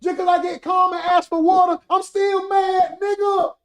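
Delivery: high, tight mock anger; mock still mad. Mad streamer after asking for water, high mock anger. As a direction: insist you're still mad while sipping water.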